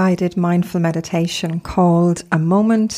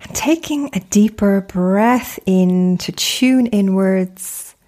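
guided meditation. Acted. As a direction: gently guide them into stillness, unhurried, phrase by phrase.

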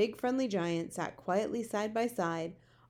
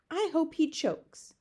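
high, slow indignant wish; drawn-out vehemence, pitched up; spiteful; 9 semitones higher, 4 dB louder, slower than usual. A narrator's reading. Sophie curses the flesh-eater, spiteful. Sophie wishes the giant harm.

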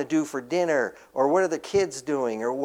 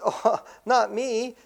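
higher, slow, playful "oh, not me"; mock coy denial; 8 semitones higher, slower than usual. Shown as a demonstration. Preacher mocking a coy disciple with a lightly teasing denial. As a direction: ham up an innocent shrug. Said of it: "No, no".

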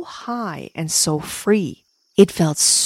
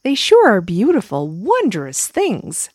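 warm ladybug voice; 3 semitones higher than usual. Acted. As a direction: coo it gently like a caring friend. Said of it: "warm, friendly hug".

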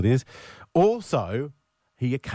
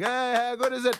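excited; 13 semitones higher than usual. Retold or imitated. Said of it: "everyone goes".

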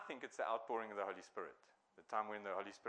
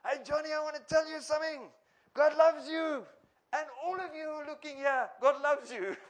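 much higher, much louder, preaching up to the windows; shouted street preaching; 11 dB louder than usual. Retold or imitated. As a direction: call God's love out to the whole block. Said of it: "he'd say".

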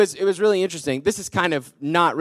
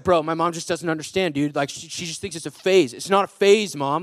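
put-on teen texting a friend; 5 semitones lower, 4 dB quieter than usual. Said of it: "Instead of a text like".

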